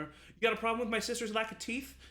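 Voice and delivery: mock threat defending her sister; protective threat. Twin warning the player who mocked her sister, cocky menace.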